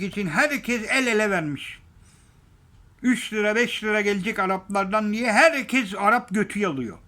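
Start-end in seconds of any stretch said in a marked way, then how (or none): none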